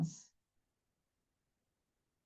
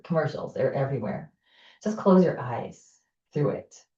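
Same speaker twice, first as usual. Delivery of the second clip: ordinary delivery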